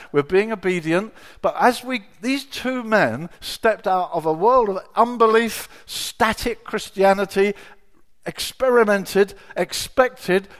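forceful preacher's exhortation; impassioned preaching. Real, not acted. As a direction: drive it home to the congregation, weighting key words. Preaches to congregation.

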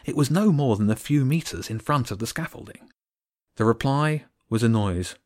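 usual speaking voice

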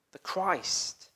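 ordinary talk

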